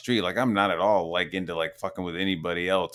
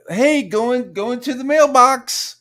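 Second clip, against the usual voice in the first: much higher, slow, put-on mocking voice; mock poster voice; 7 dB louder, slower than usual. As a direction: parody a clueless poster announcing trivia. Mocking people posting in chat.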